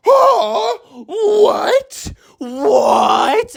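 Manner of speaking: high-pitched, loud cry of disbelief; shocked disbelief. Real, not acted. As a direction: squeal it, stunned by the twist. Reacting in shock to cliffhanger.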